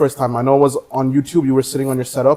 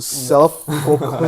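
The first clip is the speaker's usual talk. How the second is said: Self-proclaimed drawn out very slowly; slow quoting; 2 semitones higher, much slower than usual. Retold or imitated. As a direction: stretch Self-proclaimed word by word. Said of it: "a word that caught me off guard".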